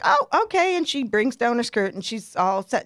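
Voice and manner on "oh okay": higher, lightly imitated sing-out; a touch of a carefree old lady; breezy older lady. Older lady finishing up with her skirt, lightly imitated breezy tone. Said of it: "finally she's like".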